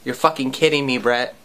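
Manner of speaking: low flat disbelief; stunned deadpan look. Retold or imitated. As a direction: say it low and unimpressed. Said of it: "looked at him kinda like this".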